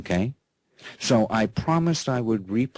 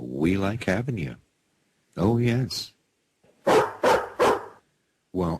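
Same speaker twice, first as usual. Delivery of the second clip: talking to a pet; 4 semitones lower than usual. Real, not acted. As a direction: coo at the dog like a proud owner. Host talks to his dog guest.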